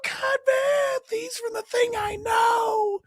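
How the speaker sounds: very high, slow shouted cheer; cheering crowd. Retold or imitated. Imitating a theatre crowd cheering a cameo, high shout. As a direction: whoop it like a thrilled fan crowd. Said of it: "all the crowd will go".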